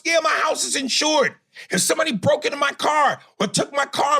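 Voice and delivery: heated, high-pitched outrage; indignant rant. Real, not acted. Host firing back at a complaint about insured stores, real indignant rant. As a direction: argue it hard, voice pushed way up.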